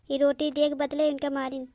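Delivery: normal talking voice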